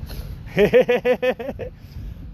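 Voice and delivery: a high, bursting real laugh; a high laugh at the joke of it all. Real, not acted. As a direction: let out a high laugh at your own joke. Laughing at his own joke.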